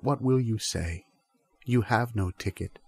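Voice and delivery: normal talking voice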